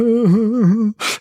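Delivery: high, wailing imitation of a girl's sobs; hammed-up high sobbing; mock sobbing. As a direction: fake a little girl's high-pitched boo-hoo. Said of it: "she's sobbing".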